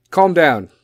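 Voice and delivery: loud, firm order with falling pitch; stern own voice. Shown as a demonstration. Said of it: "I'm gonna do it for my voice".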